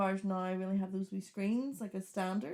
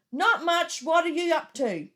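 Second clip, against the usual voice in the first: raised, high dictation to a car assistant; shouted voice command; 8 semitones higher, 5 dB louder, slower than usual. Retold or imitated. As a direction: call it out for the machine to catch. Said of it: "times of me shouting".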